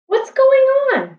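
high, loud startled cry. A narrator's reading. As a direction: blurt it out in shock, pitched high. Jack startled by gorilla tackle.

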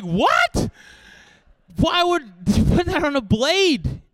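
high, loud mock disbelief; exasperated, hammed-up shout; incredulous outburst. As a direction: cry out, acting utterly baffled.

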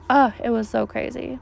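ordinary talk, nothing marked

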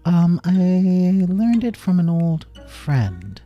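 high, slow, stalling answer; careful, drawn-out fib to a child; hesitant cover-up. A narrator's reading. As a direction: draw it out while inventing an excuse. Covering up the truth.

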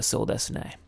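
whispered, swallowed mumble; mumbled. Shown as a demonstration. Tutor shows the filler reply to use, whispered mumble. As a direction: breathe it out barely voiced. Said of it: "just do a".

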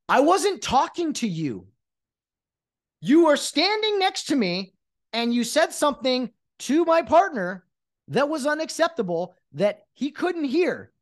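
high, emphatic rebuttal; worked-up indignant explanation; exasperated argument. Acted. Arguing with an imagined protesting player.

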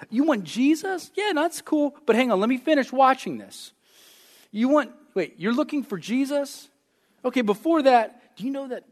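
mock-casual self-absorbed voice; distracted brush-off. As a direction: wave off the seeker while busy. Mocking distracted churchgoer.

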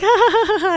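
high mock "ha ha" laugh; imitated laugh. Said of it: "And then he's like".

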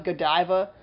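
Godiva said guh-DIE-vuh; playful pronunciation. Shown as a demonstration. Playing with brand name's pronunciations.

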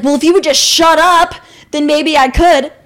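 mimicking the date's snotty comeback; mocking the date. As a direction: sneer his line back at her. Mocking his reply.

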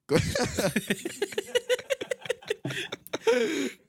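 high burst of laughter; high-pitched outburst of laughter; big laugh. Real, not acted.

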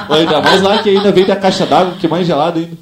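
normal talking voice

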